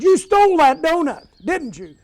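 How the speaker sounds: high, loud mock accusation, retold; accusing mother. Mother confronting her son over a stolen donut, high and loud accusing.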